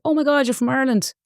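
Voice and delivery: high-pitched retold exclamation of surprise; surprised director. Director hearing her Irish accent, mock surprised. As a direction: imitate an amazed man, pitched well up. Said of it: "he was like".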